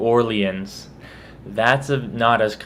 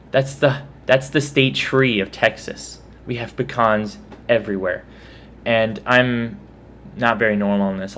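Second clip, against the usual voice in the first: ordinary talk, nothing marked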